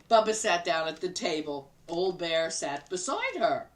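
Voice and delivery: ordinary delivery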